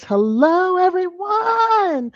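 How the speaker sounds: high, slow drawn-out hello everyone; stretched greeting. Real, not acted. Host welcoming her live audience, high and stretched out. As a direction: sing out the welcome, long and high.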